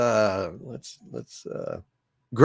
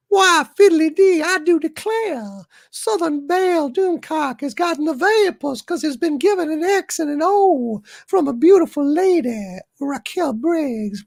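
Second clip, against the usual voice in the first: flutter like a Southern belle with the vapors; very high, swooning Southern lady. Acted. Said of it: "turned Doomcock into a Southern Belle Doomcock".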